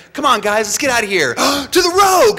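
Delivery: put-on high, loud, bubbly teen call; rally your friends like a giddy cheerleader; cheery teen girl. Mocking the TV cheerleader leaving the party, high giddy call. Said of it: "she's like".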